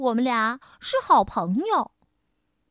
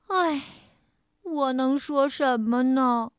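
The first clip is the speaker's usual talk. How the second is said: plain reading voice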